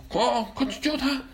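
high urgent call to rescue; urgent high cry. Acted. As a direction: cry out, go save him. Heroes urge rescue.

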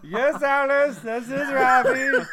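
put-on high, loud Indian-accented uncle; Ravi. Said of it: "an uncle that's from India".

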